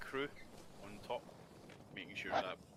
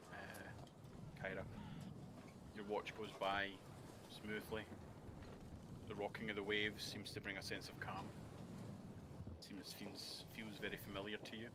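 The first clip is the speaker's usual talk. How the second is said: soft, slowing scene narration; calm narration; 7 dB quieter than usual. A narrator's reading. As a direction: set the calm scene gently. GM narrates calm night watch.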